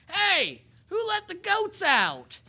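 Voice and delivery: high, loud, drawn-out holler; angry farmer shout. Acted. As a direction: yell after the runaway animals like an irate farmer. Farmer chasing escaped goats shouts.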